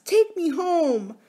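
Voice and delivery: put-on high, drawn-out child's plea; crying out. A narrator's reading. Princess begging her nurse, crying out. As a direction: plead to be taken away. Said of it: "cried the princess".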